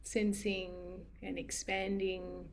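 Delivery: unhurried, gentle meditation guiding with long pauses; guided meditation. Acted. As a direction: ease listeners into noticing, phrase by spaced phrase.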